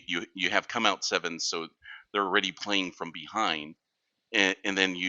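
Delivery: usual speaking voice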